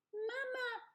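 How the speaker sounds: put-on high, tiny baby bird voice; peeping gosling. A narrator's reading. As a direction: peep it like a little hatchling. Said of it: "said the gosling".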